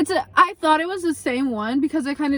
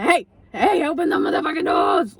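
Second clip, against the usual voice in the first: retold frantic shout at a driver; urgent calling out; 3 semitones higher than usual. Storyteller flags down Uber bus, urgent mock shout. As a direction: call out as if flagging a bus.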